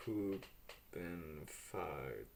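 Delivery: very slow, savouring the silly word; drawn out slowly. Real, not acted. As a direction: stretch out Poopenfaten slowly. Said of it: "I'm so immature".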